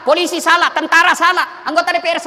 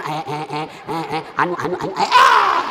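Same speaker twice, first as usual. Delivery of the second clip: mock fumbling speechmaker; 12 semitones lower than usual. Preacher mocking a legislator botching a speech, exaggerated stammering. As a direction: stammer as if lost in your script. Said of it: "sudah pakai, masih salah sambutan".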